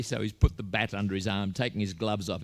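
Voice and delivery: usual speaking voice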